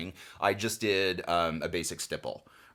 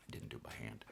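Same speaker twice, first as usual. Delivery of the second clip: much softer confession muttered under her breath; quiet aside; 8 semitones lower, 19 dB quieter, much faster than usual. Real, not acted. Quilter confessing to viewers she used a machine, hushed aside. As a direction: let it slip out as a sheepish aside.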